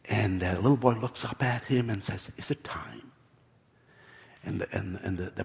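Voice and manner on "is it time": low, soft storytelling hush; softly narrated child's question. A narrator's reading. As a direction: ask it very quietly, voice dropped. Boy's hushed question in story.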